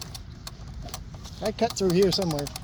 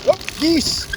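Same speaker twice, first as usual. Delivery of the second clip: surprised; 9 semitones higher than usual. Real, not acted. Startled by geese on trail.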